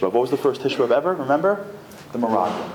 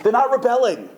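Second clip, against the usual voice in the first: defense plea; 6 semitones higher, slower than usual. Acted. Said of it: "what I would do is say".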